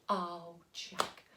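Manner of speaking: soft, low, sighing reproach; hushed motherly despair; weary disappointment. Acted. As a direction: let it out quietly, let down by your son. Mum sighs at Jack.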